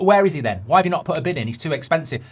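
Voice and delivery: usual speaking voice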